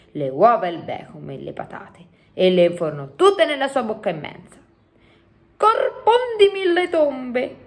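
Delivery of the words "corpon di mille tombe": high, drawn-out cry of amazement; exclaiming giant. A narrator's reading. Giant tasting human food, exclaiming in amazement. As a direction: exclaim slowly, pitched right up. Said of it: "esclamò".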